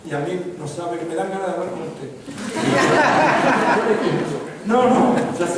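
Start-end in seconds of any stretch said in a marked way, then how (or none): 2.1-4.6 a full, loud burst of laughter; hearty laugh. Real, not acted. Speaker laughing at his own joke, loud laugh.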